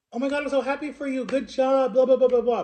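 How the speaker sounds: high-pitched gushing praise; delighted mother. Acted. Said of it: "a real mother or a mother that actually cared about you".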